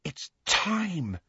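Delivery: soft wonder with a long falling tone; awestruck. A narrator's reading. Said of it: "in an awestruck voice".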